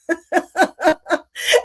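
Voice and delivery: bright amused laugh at a joke; short genuine laugh; real laugh. Real, not acted. Joke about free motion drinking.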